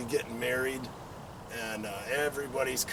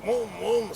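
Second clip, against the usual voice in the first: higher mock motor revving; imitated engine rev; 7 semitones higher than usual. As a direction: rev it up with your voice like a bike.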